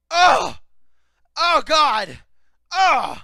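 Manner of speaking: very loud, high agonized cries; mock dying wails. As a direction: wail as if dying, hammed up. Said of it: "It sounded like she was dying".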